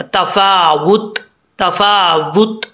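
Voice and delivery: तफावुत recited slowly, stopping on a still ता at the end; slow recitation. Shown as a demonstration. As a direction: draw out तफावुत and stop on the ता. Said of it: "वक्फ करेंगे तो ता साकिन हो जाएगा". (तफावुत धीरे पढ़ें, आख़िर में ता साकिन पर वक्फ)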